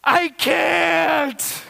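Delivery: high, loud mock wail of a small child; crying, screaming child. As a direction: imitate a four-year-old screaming through tears. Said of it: "She's just crying and screaming".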